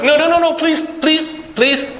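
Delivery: panicked high begging; frantic pleading. Acted. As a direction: plead desperately to stop him. Moses begging God to stop.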